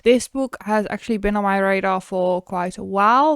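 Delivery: ordinary delivery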